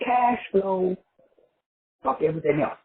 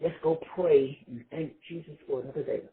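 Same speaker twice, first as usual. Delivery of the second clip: mock churchy voice; 3 semitones lower, 11 dB quieter than usual.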